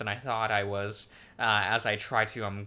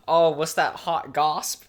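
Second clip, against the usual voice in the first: drawn-out, louder mock Zoomer gossip; mock Zoomer speech; 5 semitones higher, 7 dB louder, slower than usual. Joking that gosp means gossip, mock Zoomer speech. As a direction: ham up a slangy Zoomer asking for gossip. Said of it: "like, Zoomer speech for, for gossip".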